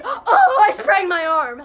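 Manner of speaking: hammed-up dramatic complaint. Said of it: "Bob's like".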